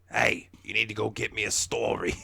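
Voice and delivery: loud grouch barking an order; hammed-up smoker's grump; grouchy boss. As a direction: demand it like a chain-smoking boss. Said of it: "She's, she talks like this".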